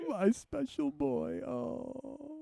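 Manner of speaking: high, soft, slow echo; mimicked doting coo. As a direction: softly mimic a doting falsetto. Echoing the doting voice.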